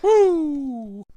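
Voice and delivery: high-pitched "woo" into a long yahoo; drawn-out playful whoops; cowboy whooping. Pretend. Whooping in reply to rating.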